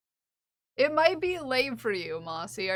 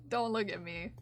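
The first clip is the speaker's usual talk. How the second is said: ordinary talk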